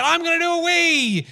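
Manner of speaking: put-on high childlike voice for the little boy; three-year-old son. Son at the party announcing a wee, high child voice. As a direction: play the toddler announcing it proudly. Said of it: "then he said".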